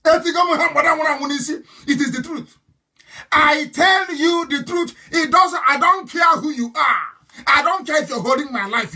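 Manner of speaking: loud, drawn-out insistence on the truth; slow then shouting defiance; defiant shout. Real, not acted. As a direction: hammer it home, shouting. Defiant rant at opponents.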